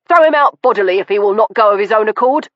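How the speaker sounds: loud, fast command to the servant; fast angry order. Acted. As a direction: snap the order at the butler. Ordering butler.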